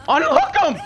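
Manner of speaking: loud high panicked yell; frantic shout. Real, not acted. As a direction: scream it at your teammates in panic. Panicked gamer yelling at teammates.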